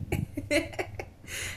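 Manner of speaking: short high breathy laugh; breathy laughter. Acted. Spirit pleased with her own boast, breathy laughing. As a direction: laugh after a playful boast.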